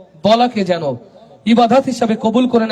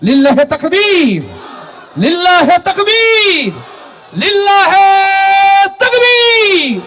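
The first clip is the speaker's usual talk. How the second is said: building from a slow loud call to a soaring, much higher shout; takbir call; 11 semitones higher, 4 dB louder, much slower than usual. Real, not acted. Preacher leading the crowd's takbir, building to a soaring shout. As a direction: stretch each takbir longer and higher until it rings.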